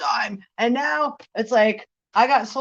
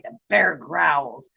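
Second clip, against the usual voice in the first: mock bear growl; 6 semitones lower, slower than usual.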